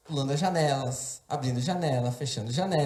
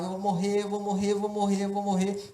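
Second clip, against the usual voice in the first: slightly raised, chanted mock cry; mild retold scream; 4 semitones higher than usual. Preacher retelling how he kept screaming as a child, mild mock cry. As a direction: repeat it as a toned-down child's wail. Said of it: "eu só gritava".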